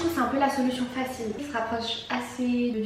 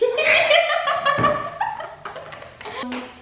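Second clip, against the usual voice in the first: long rire aigu; éclate d'un long rire; fou rire aigu; 3 dB louder than usual. Real, not acted. Fou rire sur les mochis. (fou rire aigu)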